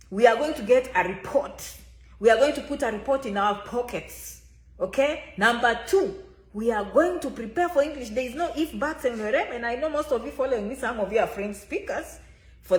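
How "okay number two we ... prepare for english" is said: ordinary delivery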